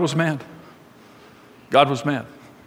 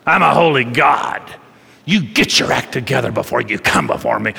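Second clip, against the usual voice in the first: stern, lowered voice of a holy judge; God; 5 semitones lower than usual. Acted. God up there scolding struggling people, stern and low. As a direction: lay down the law in a low, weighty voice. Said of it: "they have this concept that God's up there saying".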